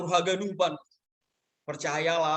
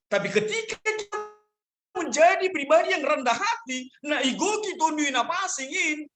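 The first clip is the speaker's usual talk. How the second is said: raised, high-pitched pulpit exhortation; preaching; 9 semitones higher than usual. Acted. Pastor urging the congregation to be humble, preaching.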